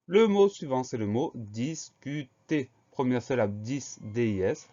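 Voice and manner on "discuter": DISCUTER drawn out as dis-cu-ter; syllable by syllable. Shown as a demonstration. Teacher introduces the next dictation word, syllable by syllable. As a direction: say discuter slowly, each syllable set apart.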